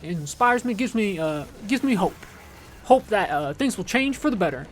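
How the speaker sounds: halting, hesitant Obama voice with lots of "uh"s, lightly done; bad Obama impression. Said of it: "this is my bad Obama impersonation".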